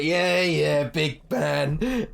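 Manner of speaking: high dismissive yeah yeah; sneering. Acted. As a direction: brush off the big man. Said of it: "they're just like".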